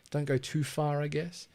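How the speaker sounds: normal talking voice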